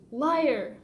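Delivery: put-on a boy's furious shout; angry accusation. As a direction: spit the word at him.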